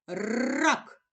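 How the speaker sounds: "рак" with a long hard rolled р; emphasised first sound. Shown as a demonstration. Teacher having a child listen for the first sound, emphasised. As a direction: stretch the trill that opens "рак". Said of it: "Який ми чуємо перший звук".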